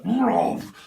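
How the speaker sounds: high, loud bark of a word; loud barked bruv. Retold or imitated. Reader voicing a Rottweiler lunging, loud bark. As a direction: bark it like an attacking dog.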